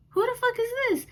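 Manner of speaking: high, sharp demand; angry retort. Retold or imitated. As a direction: fire the question back at her. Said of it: "I'm like".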